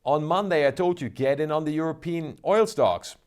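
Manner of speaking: normal talking voice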